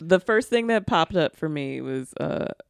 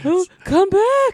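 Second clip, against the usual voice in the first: mock despair; 5 dB louder, slower than usual. Mock despair at dropped caller.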